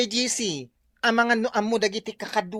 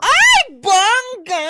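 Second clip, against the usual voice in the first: excited; 8 semitones higher, 7 dB louder, much slower than usual. Real, not acted. Thrilled about the governor.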